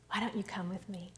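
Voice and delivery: soft fast whisper; Jesus quietly invites Jairus in, whispering. Acted.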